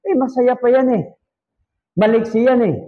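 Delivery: a hint of a shrugging relative answering; relative's casual reply. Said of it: "tinanong ko". (bahagyang ginayang sagot ng kamag-anak, mas mataas ang boses)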